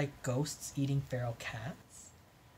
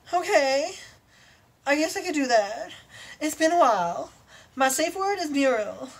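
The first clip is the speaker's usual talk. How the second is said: much higher, louder, eager unicorn voice; 10 semitones higher, 5 dB louder than usual. Acted. Unicorn telling her lover the safe words, high and eager. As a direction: chirp it out like a giddy unicorn.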